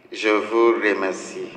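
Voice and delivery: usual speaking voice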